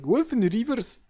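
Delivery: loud, high mock-Norseman cry; Norseman accent. Shown as a demonstration. Co-host showing how to say the team's name, Norseman accent. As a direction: call it out in an exaggerated Norseman accent. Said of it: "Norseman accent".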